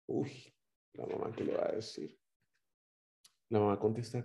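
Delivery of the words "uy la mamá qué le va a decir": whispered aside to the congregation; suspenseful whisper. Acted. Preacher whispers to the congregation, building suspense. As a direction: lean in and whisper the dilemma.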